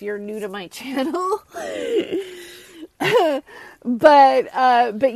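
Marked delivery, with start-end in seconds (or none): 1.5-3.8 high, loud burst of laughter; big high-pitched cackling laugh. Real, not acted. Laughs after awkward admission to viewers.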